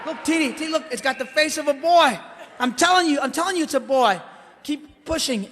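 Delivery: high, excited shouting. Retold or imitated. Father shouting at his wife mid-birth, excited. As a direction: shout it at the wife, sure it's a boy. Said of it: "It was like".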